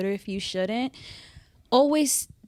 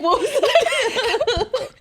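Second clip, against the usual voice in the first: high, slow, laughing what; baffled laughing question; 13 semitones higher, 5 dB louder, much slower than usual. Retold or imitated. Friend misheard a word, asking through laughter. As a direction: stretch it out, laughing in disbelief. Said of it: "I was like".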